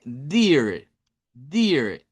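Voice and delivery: "deer" with the double E as a long Spanish I; I larga. Shown as a demonstration. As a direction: draw out the vowel in "deer", like "diir". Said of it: "Esa doble E suena como I". («deer» con I larga)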